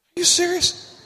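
high, squeaky disbelief; hammed-up gasping disbelief; mock shock. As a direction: play it as over-the-top amazed. Acted shocked reply again.

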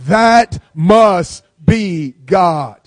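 stretched out slowly, loud, a phrase for the crowd to repeat; slow call and response. Shown as a demonstration. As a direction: lead the church to say it with you, slowly. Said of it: "someone say it with me".

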